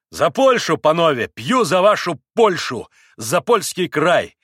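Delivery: loud, high, ringing toast; exclaimed toast. A narrator's reading. Mitya toasts Poland to the Poles, exclaiming loudly. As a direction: proclaim it with gusto. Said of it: "воскликнул Митя".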